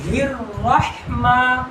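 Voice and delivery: "الرحمن" with a strong breath on the h; clearly articulated "haa". Shown as a demonstration. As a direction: bring out the throaty h in "الرحمن". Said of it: "ہا نکالنا".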